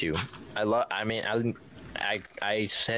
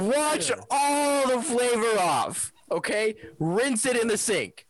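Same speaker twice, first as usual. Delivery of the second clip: shouting; 10 semitones higher, slower than usual. Real, not acted. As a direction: bellow it slowly. Heated chip argument, shouting.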